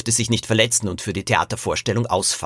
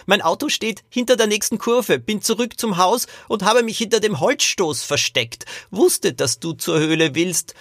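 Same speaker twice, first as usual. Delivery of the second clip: put-on high-pitched old man's character voice; sly old great-grandfather; 10 semitones higher than usual. Great-grandfather reveals to Lilo that he followed secretly, high old-man voice.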